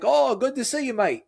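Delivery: high, hearty Australian greeting; Australian accent. Retold or imitated. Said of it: "Had a strong accent".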